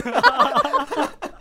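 a sudden high laugh; high burst of laughter. Real, not acted. Laughing at own awkward customs English. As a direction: laugh out loud at yourself.

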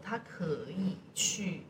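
ordinary talk

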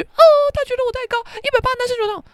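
very high, quick mock pout; squeaky mocking impression; mocking whine. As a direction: mimic a whiny friend, squeaky. Mocks classmate's whine.

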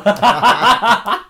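loud, high burst of laughter; real laughter. Real, not acted. Storyteller amused by his own reply, laughing. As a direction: crack up at your own story.

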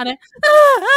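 high, loud mock crying with a laugh in it; imitated toddler wail. Mother imitating toddler tugging her shirt, mock crying. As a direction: ham up a bawling toddler, half laughing.

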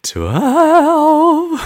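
high, loud squeal of twelve; excited shout. Real, not acted. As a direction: burst out with the number. Excited episode announcement.

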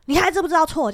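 high, loud mock scolding; desk-pounding scolding. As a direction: imitate an irate boss demanding an admission of fault. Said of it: "他又拍着桌子就说".

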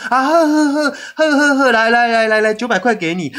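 smooth it over fast, just hand him the money; placating mother. Retold or imitated. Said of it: "说".

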